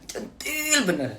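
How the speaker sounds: high, slow coy teasing; flirty coquette. Acted. Mother mocking her own flirtiness in a high voice. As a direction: coo it coyly up high. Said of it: "centilnya Bunda".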